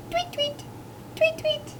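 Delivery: tiny, very high bird tweets; chittering starlings. A narrator's reading. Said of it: "The starlings chittered".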